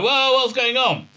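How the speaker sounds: high-pitched pretend alarm; hammed-up bewildered panic; mock freaking out. Doctor starts freaking out as well, mock alarm. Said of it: "starts freaking out as well".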